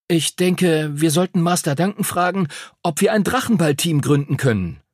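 put-on young pupil with a pet plan; hopeful schoolboy. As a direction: put forward an idea as a keen boy. Henry suggests founding a team.

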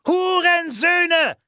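high, loud, drawn-out yell; furious scream. A narrator's reading. Walli provoking the guards away from the fugitives, furious scream. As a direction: hurl the insult out slowly at full voice. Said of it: "schrie Walli".

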